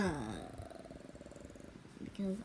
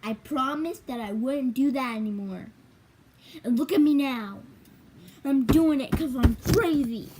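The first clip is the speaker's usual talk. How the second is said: loud playful self-scolding; louder; 2 semitones higher, 9 dB louder than usual. Real, not acted. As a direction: call it out, laughing at yourself. Loud self-mocking outburst.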